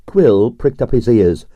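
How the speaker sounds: normal talking voice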